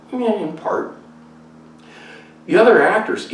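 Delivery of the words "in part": ordinary talk, nothing marked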